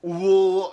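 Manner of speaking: very loud, high imitated vomit noise; mock retch. Drunk man puking in stride without stopping, mock retch. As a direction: heave out a big fake puking groan. Acts out vomiting while walking.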